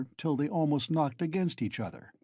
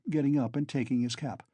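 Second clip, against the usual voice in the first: ordinary delivery, nothing marked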